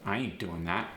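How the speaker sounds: neutral standard American, no punch. Shown as a demonstration. Teacher giving the standard intonation first, standard American. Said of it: "In standard American English, I might say".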